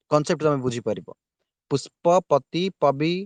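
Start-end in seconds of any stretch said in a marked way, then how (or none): 1.7-3.1 very slow, each part of ପୁଷ୍ପପତି drawn out; teacher sounding out the words slowly; slow pronunciation demo. Shown as a demonstration. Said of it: "ତମେ ଉଚ୍ଚାରଣ ନିଜେ କର". (ଧୀରେ ଧୀରେ ଉଚ୍ଚାରଣ କରି ଦେଖାଇବା)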